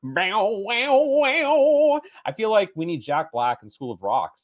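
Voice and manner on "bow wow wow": high, rising mouth-guitar "bow, wow"; guitar lick imitation. Podcaster imitating the movie's guitar licks, mouth-guitar riff. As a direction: sing out the riff like a screaming guitar.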